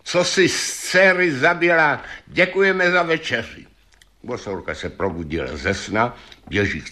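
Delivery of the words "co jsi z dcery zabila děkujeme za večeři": high-pitched taunting call. A narrator's reading. Janko shouting to the witch under her window, calling out. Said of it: "zavolá".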